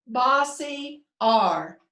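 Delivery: "bossy R" very slow, word by word; drawn-out answer. Shown as a demonstration. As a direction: chant "bossy R" slowly for the class. Teacher leads class chanting answer.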